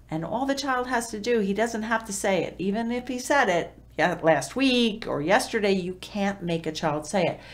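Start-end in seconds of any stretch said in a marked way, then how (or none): none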